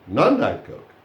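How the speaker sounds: slow, with non punched in non-Diet; contrastive stress on non. Shown as a demonstration. Teacher spells out the contrast, slowly stressing non in non-Diet. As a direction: stretch it out and hit non. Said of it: "it means".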